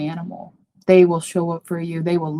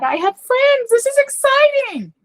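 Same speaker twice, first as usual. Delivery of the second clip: high, squeaky mock cheer; mock excited; 7 dB louder, slower than usual. Mocking her past self with a giddy squeal. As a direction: play the giddy partygoer she pretended to be.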